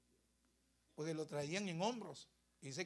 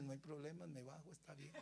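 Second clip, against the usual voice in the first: very soft, lowered, submissive reply; meek surrender; 3 semitones lower, 13 dB quieter than usual. Acted. Death yielding to Jesus's order, meek and quiet. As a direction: give in quietly, dying away.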